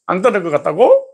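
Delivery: ordinary talk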